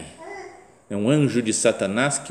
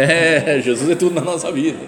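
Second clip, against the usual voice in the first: laughing, humouring reply; amused agreement; 6 semitones higher, 8 dB louder than usual. Retold or imitated. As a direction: play along with a laugh. His embarrassed reply to the shouter.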